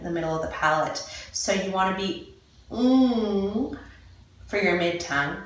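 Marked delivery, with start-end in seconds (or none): 2.7-3.8 NG held as a long nasal hum; sustained NG sound. Shown as a demonstration. Teacher showing the mid-tongue position, NG held long. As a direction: draw out NG on its own. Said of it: "The N G is the sound".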